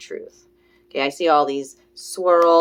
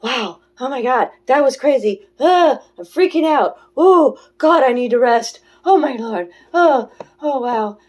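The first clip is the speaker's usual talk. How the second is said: high, loud mock panic; hammed-up "oh my God" overwhelm; freaking out; 6 semitones higher, 7 dB louder than usual. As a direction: play an overwhelmed freak-out, gasping out each exclamation. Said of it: "My old energy come in and was like".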